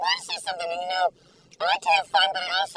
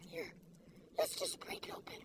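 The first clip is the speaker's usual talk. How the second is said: soft, hushed whisper; 5 semitones lower, 18 dB quieter than usual. Real, not acted. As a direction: whisper it like a secret treat. Breaking the cookie open, hushed.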